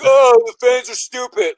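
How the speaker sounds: a drawn-out mock of a lazy heel; mocking smug heel. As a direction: sneer it like a bored villain. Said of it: "he's like".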